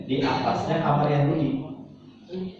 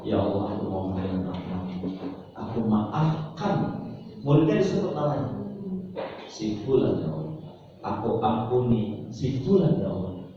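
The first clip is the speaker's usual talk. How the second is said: quiet prayer; 6 semitones lower, 4 dB quieter than usual. Shown as a demonstration. Teacher demonstrates forgiving prayer for a student, soft and humble. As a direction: pray softly as if alone with God. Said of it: "ngomongnya begini".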